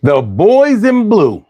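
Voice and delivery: sing-song fake admiration for the cops; sarcastic praise. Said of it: "I'm lying".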